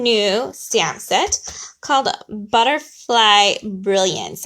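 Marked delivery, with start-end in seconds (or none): 2.3-4.4 slow, deliberate enunciation of a tongue twister; slow and careful. Real, not acted. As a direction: say it really slowly, word by word. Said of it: "have to say that really slow".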